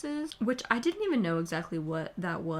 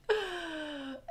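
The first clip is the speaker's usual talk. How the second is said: emotional gasp; 9 semitones higher, 6 dB quieter than usual. Real, not acted. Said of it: "not okay".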